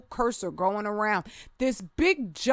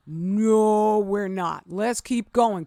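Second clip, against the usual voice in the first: ordinary delivery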